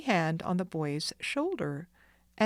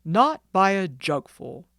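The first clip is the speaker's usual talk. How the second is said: louder, firm, certain declaration; emphatic denial; 8 dB louder than usual. Acted. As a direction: stamp out the lie firmly. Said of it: "declared the doctor emphatically".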